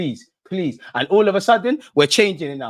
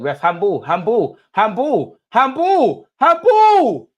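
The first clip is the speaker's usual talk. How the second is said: building from raised voice to high shout; escalating appeal; 7 semitones higher, 6 dB louder, much slower than usual. Real, not acted. Fan appealing to the ref for handball, escalating shout. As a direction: repeat it louder and higher each time.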